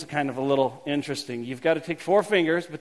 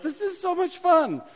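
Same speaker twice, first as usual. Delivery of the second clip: high, squeaky mock delight; mock excited congregation; 12 semitones higher than usual. Pastor mimicking a laughing congregation, high and squeaky. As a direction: squeal it like a giddy kid. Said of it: "They're like".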